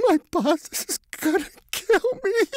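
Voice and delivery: very high weeping complaint; crying out his fear of the boss; sob then mumble. A narrator's reading. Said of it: "he mumbles through the tears".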